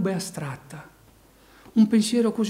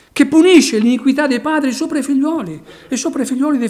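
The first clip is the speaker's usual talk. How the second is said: preaching at full force; 7 semitones higher, 10 dB louder, faster than usual. Acted. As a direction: drive each phrase home like a revival preacher. Proclaiming God's judgment.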